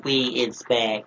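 slow, odd alien voice. Pretend. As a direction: say it like a strange alien. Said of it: "Sound like an alien talking".

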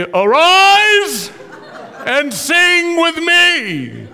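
slow hammed-up royal decree; grand command. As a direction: proclaim it like a god summoning the crowd. Uncle commanding stadium, played grand.

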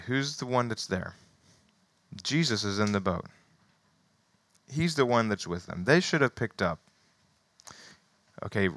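ordinary talk, nothing marked

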